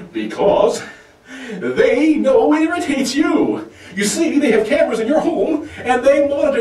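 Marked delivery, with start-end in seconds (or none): none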